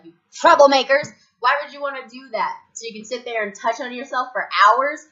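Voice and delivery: high, loud mock outrage; scolding. To friends who suggest getting high, mock scolding. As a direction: tell them off, loud and hammed-up. Mimics own scolding of smokers.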